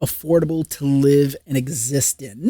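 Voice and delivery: ordinary talk, nothing marked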